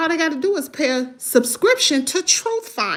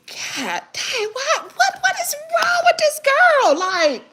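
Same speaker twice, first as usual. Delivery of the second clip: retelling her reaction to the live video, incredulous and much higher; 13 semitones higher than usual. Retold or imitated. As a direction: play your own stunned reaction big. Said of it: "I was like".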